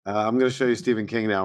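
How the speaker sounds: ordinary delivery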